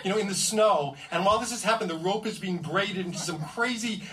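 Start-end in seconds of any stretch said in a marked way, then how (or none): none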